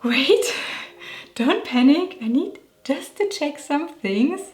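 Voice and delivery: flustered, pitch jumping up; mock panic. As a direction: act out a small panic before saying a word. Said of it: "Every time I'm going to say".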